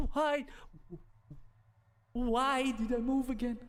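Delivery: high-pitched, playful wail of frustration; mock crying. Gamer lamenting an accidental move, mock crying. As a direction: whine it high as if about to cry. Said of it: "I'm crying, man".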